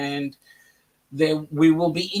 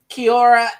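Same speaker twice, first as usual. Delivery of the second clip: Kiwi accent, higher and louder; Kiwi greeting; 5 semitones higher, 8 dB louder than usual. Shown as a demonstration. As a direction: demonstrate a New Zealand kia ora. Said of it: "in Kiwi".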